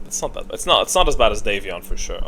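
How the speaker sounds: usual speaking voice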